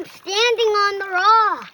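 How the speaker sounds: yelling. Real, not acted. Kid yelling back.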